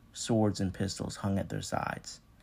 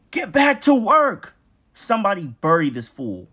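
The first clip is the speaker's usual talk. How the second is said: furious bellow; 12 semitones higher, 13 dB louder than usual. Acted. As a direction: scream it across the field. Overseer bellowing at workers.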